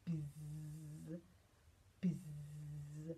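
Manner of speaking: low, soft, steady buzzes; two long low bee buzzes. Acted. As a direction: buzz gently like a bee. Imitates bees making honey.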